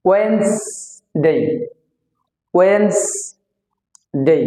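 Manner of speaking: ওয়েন্সডে very slow as "wens", pause, "dey"; two-syllable pronunciation. Shown as a demonstration. Teacher drilling two syllables, correct pronunciation. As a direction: drop the d, split ওয়েন্সডে in two. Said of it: "এখানে দুইটা syllable হবে".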